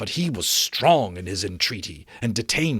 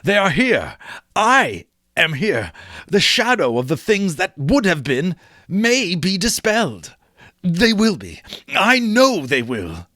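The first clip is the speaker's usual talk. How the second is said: higher, louder giddy relief; Scrooge crying out; 7 semitones higher, 3 dB louder than usual. Acted. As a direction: cry out in disbelieving joy. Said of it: "cried Scrooge".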